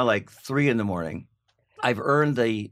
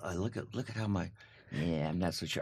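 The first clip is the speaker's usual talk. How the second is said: low, very soft murmur; imitated self-critical muttering; doubtful mumble; 5 semitones lower, 12 dB quieter than usual. Suspects critique their newspaper picture.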